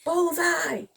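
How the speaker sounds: high exclamation; triumphant. A narrator's reading. Reader celebrating the soldier's shot, triumphant. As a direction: cry out the hit.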